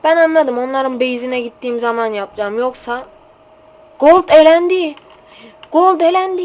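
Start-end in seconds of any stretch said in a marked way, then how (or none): 4.0-6.4 yüksek ve yüksek sesle haykır; takım elenince coşkuyla bağır; heyecanlı bağırış. Real, not acted. (coşkulu bağırış)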